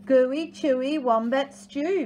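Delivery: proud boast, pitched a little high; lightly hammed-up bragging; boastful. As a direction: show off as a storybook dingo. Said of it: "boasted Dingo".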